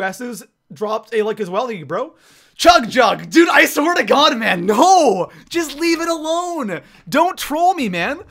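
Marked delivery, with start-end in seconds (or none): 2.5-8.2 loud, high-pitched frustrated protest; exasperated outburst. Real, not acted. Gamer begging not to be trolled, exasperated. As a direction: cry out at being trolled.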